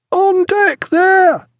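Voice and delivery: very high, long-drawn call; lookout's hail. A narrator's reading. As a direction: hail the deck from the masthead. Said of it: "hailed the lookout".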